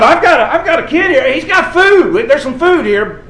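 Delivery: high, loud, laughing delight; excited Andrew. Acted. Preacher voicing Andrew showing the boy's food, excited and laughing. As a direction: voice Andrew eagerly announcing food.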